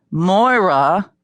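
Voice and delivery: wailing. A narrator's reading. Hungry woman wails the coach's name, rising. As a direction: call the name out in a hungry wail. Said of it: "she wailed again".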